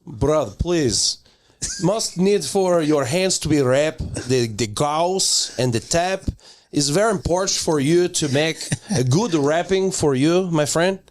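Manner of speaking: put-on Brazilian accent. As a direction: plead your case as a Brazilian coach.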